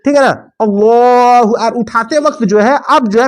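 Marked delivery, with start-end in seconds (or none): 0.6-1.7 long, chanted Allahu Akbar, a bit louder; imam's drawn-out call; imam's takbir. Shown as a demonstration. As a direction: call it out like the imam leading prayer. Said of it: "मेरा position देख रहे हैं ना".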